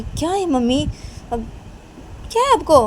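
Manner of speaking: high, loud acted complaint; hammed-up annoyed whine; exasperated daughter. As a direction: push back at mom in a high voice. Daughter objects to mother's teasing.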